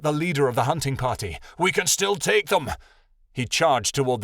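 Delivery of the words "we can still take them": higher, eager battle cry; defiant cry. A narrator's reading. Shadowin defies Jade and charges, defiant and higher. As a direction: argue back in a raised pitch.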